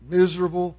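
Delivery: usual speaking voice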